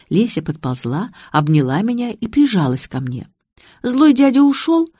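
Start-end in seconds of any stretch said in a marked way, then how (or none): 3.8-4.9 put-on high little-girl question; childlike girl. A narrator's reading. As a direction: ask anxiously like a small child. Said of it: "по-детски".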